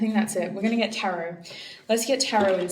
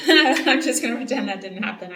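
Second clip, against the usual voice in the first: laughing it off, a bit louder; 3 semitones higher, 7 dB louder than usual. Real, not acted. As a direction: giggle through the line, embarrassed. Laughing at her own slip.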